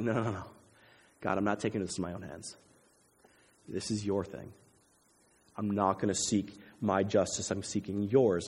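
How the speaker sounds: low, soft, humble declining; quiet refusal. Retold or imitated. David declines to take revenge, telling God softly. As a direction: hand it over gently, voice dropped.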